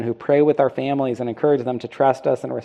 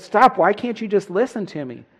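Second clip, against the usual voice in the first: frustrated wife; 8 semitones higher than usual. Retold or imitated. Wife in the video cutting off her husband, frustrated and pleading. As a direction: snap at him to stop, then beg to be heard. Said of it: "And she goes".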